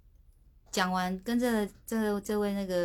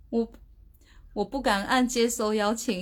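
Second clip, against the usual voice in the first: usual speaking voice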